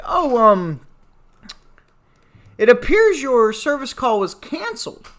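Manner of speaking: mock customer service voice; imitating the rep. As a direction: mimic a flustered call-center agent.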